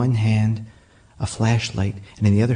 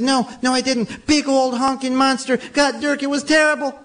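much higher, frantic and rushed; a scared kid describing a monster; breathless panic; 13 semitones higher than usual. Retold or imitated. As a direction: wake your parents in a terrified rush. Boy insisting to his father.

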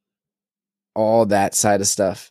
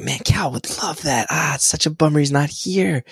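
mock wistful whisper; 7 semitones higher than usual. Wishing son were there.

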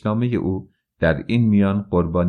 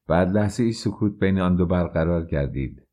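ordinary delivery

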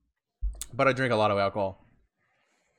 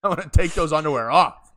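laughing; 4 semitones higher, 5 dB louder than usual. Acted. Asked to talk dirty.

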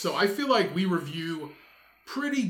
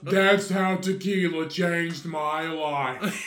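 put-on drunk woman voice; much slower than usual. Comic drunk woman persona.

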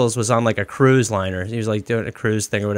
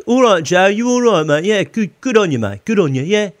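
higher, broad West Midlands accent from Stourbridge; 7 semitones higher than usual. Shown as a demonstration. Showing how his family in England talk, West Midlands accent. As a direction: put on the broad West Midlands twang, mate-heavy. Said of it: "the accent is more like".

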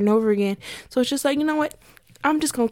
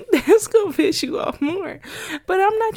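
laughing; 8 semitones higher than usual. Real, not acted. About the negative person, laughing with high glee. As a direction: cackle it out with glee.

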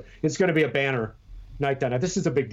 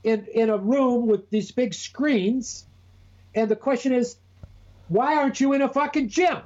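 high, exasperated disbelief; incredulous rant; 12 semitones higher than usual. Real, not acted. Ranting to Eric about the convention, incredulous and high. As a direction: pitch it up in disbelief.

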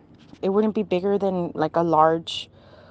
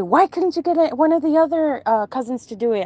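higher, louder complaining question; retold protest; 7 semitones higher, 4 dB louder, faster than usual. Reluctant relative asks uncle why not the cousins, in high-pitched protest. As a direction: push back, starting high and easing down.

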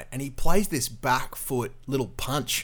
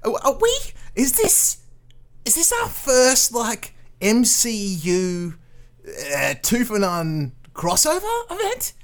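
baffled; 8 semitones higher than usual. Real, not acted. Bewildered by the bot.

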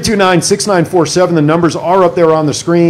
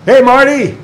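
higher, louder greeting call; 7 semitones higher, 4 dB louder, slower than usual. Real, not acted. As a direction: call out to the guest. Calling to guest on line.

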